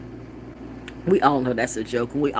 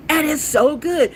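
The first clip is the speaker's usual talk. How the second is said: higher slowed so good; delighted; 12 semitones higher, 4 dB louder, slower than usual. Real, not acted. Raving to viewers about carrot pie. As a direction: savor the words. Savoring again.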